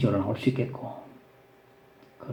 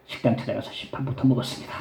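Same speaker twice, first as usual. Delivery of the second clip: normal talking voice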